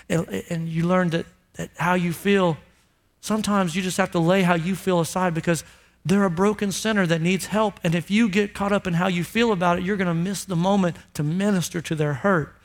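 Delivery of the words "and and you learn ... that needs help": usual speaking voice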